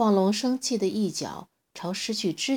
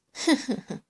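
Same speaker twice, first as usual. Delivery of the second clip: sneering laugh; 7 semitones higher than usual. A narrator's reading. T-rex sneering and daring the little dinosaur to fight. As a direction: sneer at him and dare him. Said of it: "乌提莫冷笑道".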